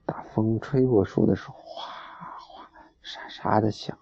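soft whisper of rustling leaves. Acted. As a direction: hush it like wind in trees. Reading about wind in trees. (轻声耳语)

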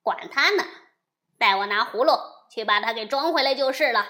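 loud, fast and careless demon chief; loud offhand villain; dismissive demon. A narrator's reading. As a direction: rattle it off, not worried at all. Silver Horn brushes it off.

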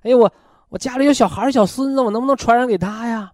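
quick, high-pitched retold plea; worried old lady asking. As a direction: imitate an anxious old woman's question. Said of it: "一个老太太得肺结核还问我".

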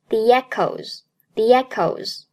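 "the echoes" said as "thee-yechoes"; linking y glide. Shown as a demonstration. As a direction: slip a y between "the" and "echoes". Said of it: "同样中间夹一个yet的音".

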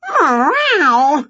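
high loud mock cat meow. A narrator's reading. Cat Wilbur answering Winnie, high meow. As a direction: yowl like a cat. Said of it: "went Wilbur".

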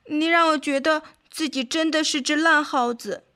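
a touch of acted weeping; mildly tearful. A narrator's reading. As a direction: plead as if close to tears. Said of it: "哭泣着说道".

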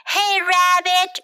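shouted. A narrator's reading. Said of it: "shouted bird".

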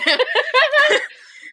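shrieking laugh; can't hold back the laugh; high burst of laughter. Real, not acted. Laughing at the runaway. (尖声大笑)

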